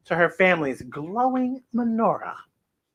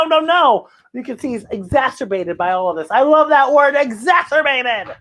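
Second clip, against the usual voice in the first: plain reading voice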